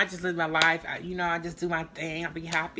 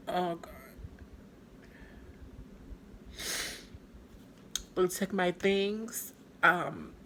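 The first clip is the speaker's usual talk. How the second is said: upset; 6 dB quieter, slower than usual. Real, not acted. Reliving assault, breaking down.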